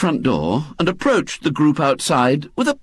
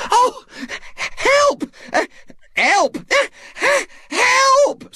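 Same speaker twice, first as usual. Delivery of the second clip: screaming; much slower than usual. Acted. Mrs Bott hit by a rotten apple, screaming for help. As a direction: shriek as the apple hits. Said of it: "screamed Missus Bott".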